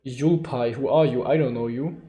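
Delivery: ordinary talk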